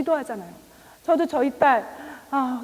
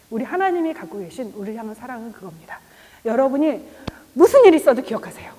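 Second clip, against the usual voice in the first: normal talking voice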